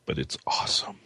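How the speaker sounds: whispered aside. Real, not acted. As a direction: whisper it under your breath. Aside after criticism.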